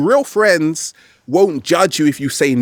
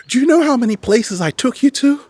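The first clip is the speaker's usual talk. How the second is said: higher, mock guilt trip from a relative; guilt-tripping relative; 10 semitones higher than usual. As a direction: lightly play the nagging elder who wants credit. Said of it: "they give you this guilt trip".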